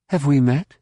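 mildly startled demand. A narrator's reading. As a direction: demand an answer, caught off guard. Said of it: "Mara demanded".

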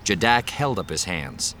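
plain reading voice

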